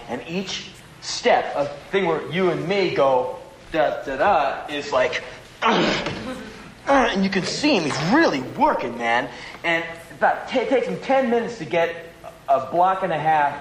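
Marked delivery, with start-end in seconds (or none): 5.6-7.8 slow high strained effort noise; straining grunt. Retold or imitated. Comic mimicking a man straining on crutches, slow strained grunt. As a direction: act out the struggle, slow and high.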